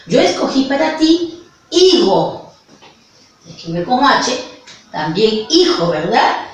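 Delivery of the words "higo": "higo" with the first syllable "hi" stressed and falling. Shown as a demonstration. Teacher presenting "higo" to first graders as the word with stress on I. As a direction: lean on the "i" of "higo" and let it fall. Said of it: "que su mayor fuerza sea I".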